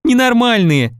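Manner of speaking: high-pitched amused exclamation; laughing. A narrator's reading. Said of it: "засмеялась Нюшка". (высоко, смешливо)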